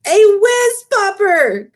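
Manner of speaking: high, loud and slow delighted cry; beaming cry. Acted. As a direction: shout it out, grinning with joy. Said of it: "cried the B F G, beaming".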